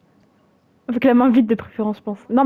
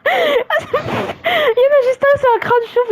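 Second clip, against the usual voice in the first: laughing; 12 semitones higher, much slower than usual. Real, not acted. Laughing at villagers.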